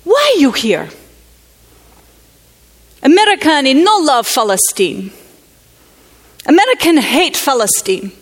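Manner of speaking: a hint of the grieving woman's bitter challenge; challenging grieving woman. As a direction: confront the outsider with grief. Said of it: "a challenging expression in her face".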